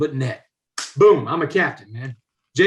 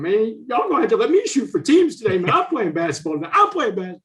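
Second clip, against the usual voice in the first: higher, forced tough voice that cracks; mock-tough voice cracking; squeaky cracking tough voice; 4 semitones higher than usual. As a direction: try to sound hard while the voice squeaks. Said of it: "the toughest voice I could find, squeaking and crackling".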